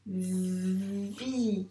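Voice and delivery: "Vi" with a very long v, then held i; sounded-out syllable. Shown as a demonstration. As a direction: buzz the v of "Vi" slowly as if writing it. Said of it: "donc on doit le dire, les sons".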